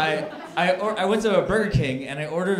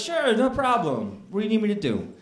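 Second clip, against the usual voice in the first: chipper cashier; 5 semitones higher than usual. Retold or imitated. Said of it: "And he goes".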